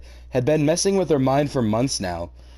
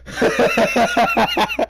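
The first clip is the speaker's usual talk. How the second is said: shrill burst of laughter, far above the speaking voice; helpless high-pitched cackle; high shrieking laugh; 4 dB louder than usual. Real, not acted. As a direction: crack up in a squealing laugh. Laughs at the fanfic.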